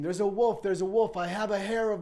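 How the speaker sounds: very high, loud crying-wolf shout; mock alarm cry. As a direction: scream a false alarm in the street.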